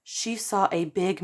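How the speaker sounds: usual speaking voice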